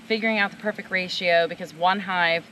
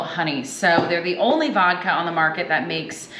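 louder, bright show-host read presenting the spirit; upbeat cocktail demo host; 4 dB louder than usual. Acted. Host to viewers, introducing Bar Hill cocktail, louder upbeat presenter read. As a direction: sell it like a cooking-show host.

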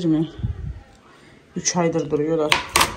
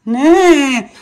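higher and louder, losing patience; impatient; 8 semitones higher, 5 dB louder than usual. Real, not acted. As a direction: snap the question out, fed up. Mother, impatient with the crying.

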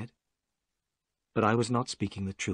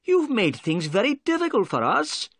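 higher, angry reproach; angry outburst; 11 semitones higher than usual. Acted. Inspector scolding the prisoner, angry and raised. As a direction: snap at the prisoner in frustration. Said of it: "cried Inspector Jones angrily".

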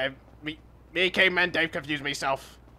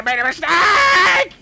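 much higher, louder, slow scream; screaming in dismay; 11 dB louder, slower than usual. Acted. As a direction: scream the mistake out. Caveman crashes, screams.

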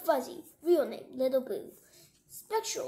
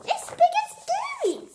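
very high, loud squirrel squeal; panicked squirrel; 7 dB louder than usual. Acted. Squirrel describing the threat to Sugar, panicked. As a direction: squeal it in cartoon alarm. Frightened squirrel character repeats its alarm.

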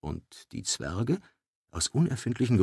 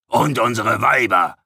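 threatening; 3 semitones higher, 10 dB louder than usual. Acted. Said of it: "sekundierte ... rollte drohend mit den Augen".

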